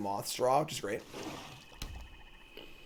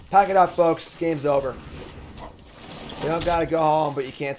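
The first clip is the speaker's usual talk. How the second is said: mock announcement; 3 semitones higher, 6 dB louder than usual. Real, not acted. Gamer telling viewers the game is won, loud mock call. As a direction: announce the game is over with glee.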